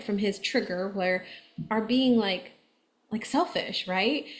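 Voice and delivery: ordinary talk, nothing marked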